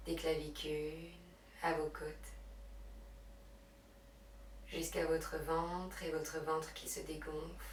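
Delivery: hushed, unhurried meditation guidance; guided relaxation. Real, not acted. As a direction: guide them gently into breath and rest. Leads yoga relaxation and breathing.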